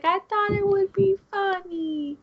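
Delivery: put-on very high, innocent girly voice; cheeky girlfriend. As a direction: play it coy and innocent. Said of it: "And she was like".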